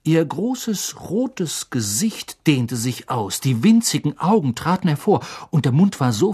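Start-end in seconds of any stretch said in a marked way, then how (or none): none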